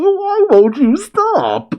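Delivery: a squeaky, whining Gas; high whiny villain voice. Retold or imitated. Reviewer voices the beaten villain, high and whiny. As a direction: pitch way up and plead.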